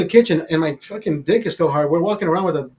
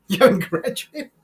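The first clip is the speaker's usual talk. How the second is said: laughing disbelief; 3 semitones higher than usual. Retold or imitated. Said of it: "I said".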